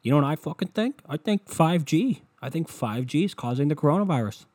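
worked-up amateur expert impression; conspiracy theorist. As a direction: imitate someone proudly airing a crackpot theory. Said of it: "when somebody starts a sentence about coronavirus and they go".

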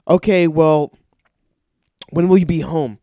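loud, strained patience running out; exasperated loud question. Retold or imitated. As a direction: force out the question with rage building. Rage building before he asks.

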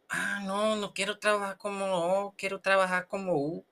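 high, whiny little refusal; protesting letter O. Acted. Letter O refusing to work as O, whining.

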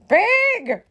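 high, loud single-word yell; storybook outraged holler; angry shout. A narrator's reading. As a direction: hurl it as an insult. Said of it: "shouted Eugenia".

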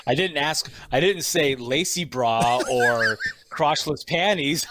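normal talking voice

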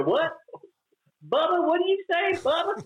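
high-pitched, puzzled Southern holler; baffled Southern relative. Retold or imitated. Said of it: "my family didn't understand me".

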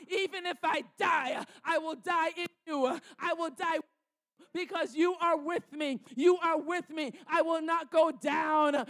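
rising, impassioned preacher's prayer that builds to shouts; fervent prayer. Acted. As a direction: pray it out boldly over the congregation. Fervent prayer over Psalm 23.

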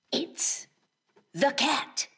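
hushed then high sly cat voice; playful burglar whisper. Acted.

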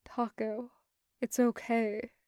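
soft, sighing and very quiet; hushed, resigned near-sigh. Acted. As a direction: breathe it out so only Taco hears. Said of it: "he says, almost sighs, quietly".

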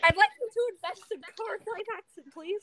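high cartoon mouse squeak, politely asking; Mickey Mouse impression. Still doing Mickey for friends, asking them to invest in car financing. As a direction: pitch way up in a mock Mickey voice. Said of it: "a mediocre Mickey Mouse impression".

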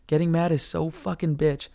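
usual speaking voice

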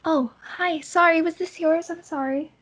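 put-on startled young girl saying sorry; little girl. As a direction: speak up high like a sheepish little girl. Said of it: "She kinda looks up at you and goes".

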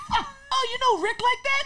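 very high, laughing mock girl voice; imitating the girl. Actor imitates the impressed girl, squealing high with laughter. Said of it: "She's like".

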